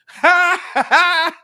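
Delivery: high cackling laugh; a sudden loud laugh at the clip; loud burst of laughter. Real, not acted. Reaction to clip.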